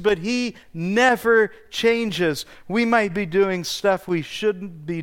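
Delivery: building sermon crescendo; preaching. Acted. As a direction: hammer each contrast home to the congregation. Preaching contrasts at rising force.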